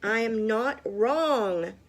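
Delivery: loud, slow, insistent denial; indignant shout. A narrator's reading. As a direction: hammer out the denial. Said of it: "Eugenia shouted".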